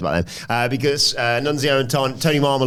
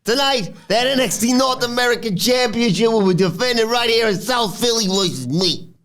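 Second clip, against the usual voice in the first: Nunzio impression; 10 semitones higher than usual. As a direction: lay down the challenge like a boss. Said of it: "Nunzio says".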